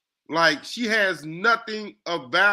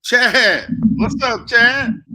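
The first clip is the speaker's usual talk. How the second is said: calling out; 7 semitones higher, 9 dB louder, much slower than usual. Real, not acted. Greeting co-host by calling out.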